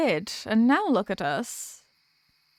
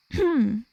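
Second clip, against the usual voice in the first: a showy ahem before the tale; theatrical throat clear. Acted. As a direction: clear your throat like starting a performance. Before retelling the story.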